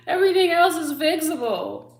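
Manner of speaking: high, loud laughter through the words; cracking up at her own story; laughing loudly. Real, not acted. Laughing outburst.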